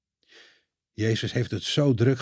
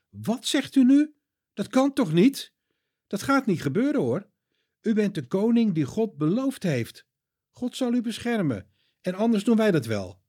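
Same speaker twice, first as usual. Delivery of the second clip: upset protest; 10 semitones higher than usual. Acted. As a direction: protest as if he cannot accept it. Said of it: "zegt Petrus boos". (boos en ontdaan, veel hoger)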